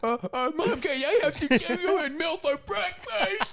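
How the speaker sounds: put-on high, loud whiny Adam Sandler kid voice, laughing; a kid talking like Adam Sandler asks Mom for cereal. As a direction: ham up a Sandler man-child begging Mom. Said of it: "they sound just like Adam Sandler".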